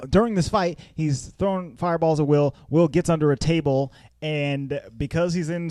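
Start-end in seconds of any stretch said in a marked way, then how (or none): none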